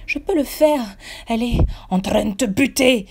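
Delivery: high, loud golem voice; golem retort. A narrator's reading. Golem Hicham retorting to the narrator. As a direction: retort urgently in the sidekick voice. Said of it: "il réplique".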